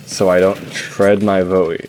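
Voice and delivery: slow, careful to spare the voice; slow it down to save the throat. Real, not acted. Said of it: "let me talk slower".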